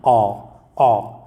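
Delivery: अ as a low open "aw", twice; low, rounded अ, repeated; vowel sound demo. Shown as a demonstration. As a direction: model अ as an isolated "aw". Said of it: "sound इसका क्या आ रहा है".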